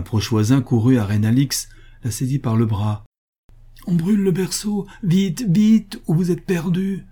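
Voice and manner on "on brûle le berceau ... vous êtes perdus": high-pitched alarmed warning; urgent cry. A narrator's reading. Old neighbour warning of the fire, crying out urgently. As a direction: cry out to hurry them away from danger. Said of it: "s'écria-t-il".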